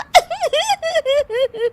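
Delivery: inappropriate giggling. Acted. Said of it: "इस हरकत से हैरान".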